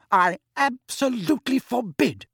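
high, overwrought ban; shouting. A narrator's reading. Mother standing up to forbid it, shouting. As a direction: forbid it, voice pitched right up. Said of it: "shouted Missus Weasley".

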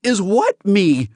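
high, loud, alarmed question; taken aback, voice jumping up; startled spurt. Acted. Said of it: "Alan managed to spurt out".

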